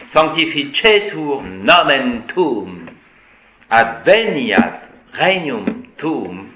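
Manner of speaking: slow, every stress hammered; heavy French-style stress. Shown as a demonstration. Teacher mocking how the French stress Latin. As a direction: plod through stressing each word. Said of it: "quand le français veut mettre l'accent".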